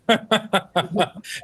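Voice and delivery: high bubbly laugh. Real, not acted. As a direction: let out a bright laugh. Laughing about the volunteers.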